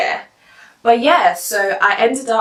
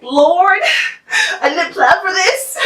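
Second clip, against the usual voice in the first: high, loud squeal breaking into laughter; mock dismay; 11 semitones higher, 6 dB louder, slower than usual. Reacting to the phone row, a high mock-dismayed squeal. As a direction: squeal it up high in comic panic.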